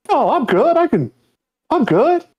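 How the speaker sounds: very high, strained clown voice; mournful sad clown whine. Acted. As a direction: squeak out a brave front. [name] as clown answers.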